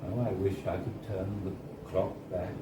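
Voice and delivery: plain reading voice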